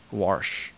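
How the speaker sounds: "wash" said with an R, like "warsh"; added R. Retold or imitated. Saint Louis locals explaining how they say it, intrusive R in "wash". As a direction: put an R before the SH in "wash". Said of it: "that's how we say".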